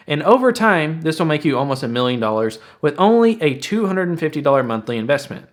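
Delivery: ordinary talk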